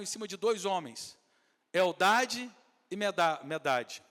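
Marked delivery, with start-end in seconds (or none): 1.7-4.0 slow careful names. Shown as a demonstration. Spelling out biblical names slowly.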